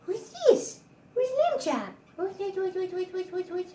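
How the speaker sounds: excitable pet-play squeak. Real, not acted. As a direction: coo in a tiny high voice, teasing with a toy. Baby-talks to dog with toy.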